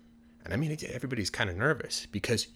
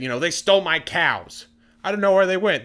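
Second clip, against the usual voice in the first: high, loud, distressed complaint; acted exasperation, pitched up; upset villager; 8 semitones higher, 7 dB louder than usual. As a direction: whine loudly as the robbed farmer.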